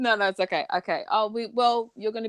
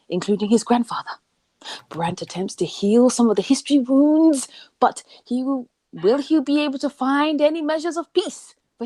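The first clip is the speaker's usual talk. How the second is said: theatrical storyteller building to a cliffhanger question; suspenseful trailer-style read; dramatic blurb reading. Acted.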